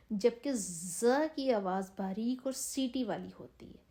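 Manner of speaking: normal talking voice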